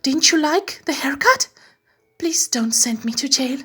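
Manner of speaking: high, anxious begging; pleading. Acted. Meg begging the king not to jail her, high anxious plea. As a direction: beg for mercy, voice lifted.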